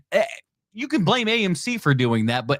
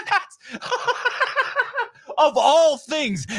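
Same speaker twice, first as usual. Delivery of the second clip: high, laughing words, slowing at the end; real laughter; 12 semitones higher, much slower than usual. Real, not acted. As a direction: laugh through disbelief. Laughs at British imperialism argument.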